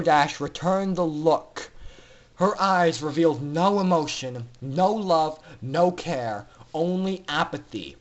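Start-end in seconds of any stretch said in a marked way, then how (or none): none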